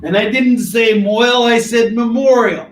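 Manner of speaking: loud, high-pitched protest; mock-indignant correction. Streamer corrects a mishearing about a comic title, mock-indignant. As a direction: shout the correction in mock outrage.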